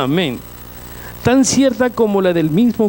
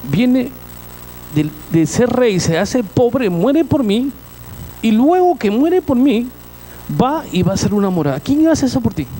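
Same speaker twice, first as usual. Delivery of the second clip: rising preaching fervor; 5 semitones higher than usual. Acted. Climactic praise of Christ's sacrifice.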